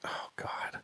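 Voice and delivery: very soft whispered aside; retold sinking dread, whispered; whispered dismay. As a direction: breathe it out under the breath. Dread on hearing friend's denial.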